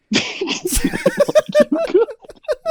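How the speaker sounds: loud high shrieking laugh. Real, not acted. Laughter burst.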